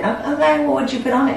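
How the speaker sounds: soft, high, careful question; humouring. Retold or imitated. Humouring a sister who can't cook, tentative.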